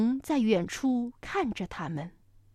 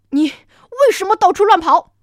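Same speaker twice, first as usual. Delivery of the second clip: scolding; 6 semitones higher, 11 dB louder than usual. Acted. T. rex scolds runaway.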